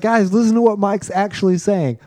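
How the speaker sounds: high-pitched appeal to friends; retold urgent plea. Teller replaying a warning to movie-club friends, urgent.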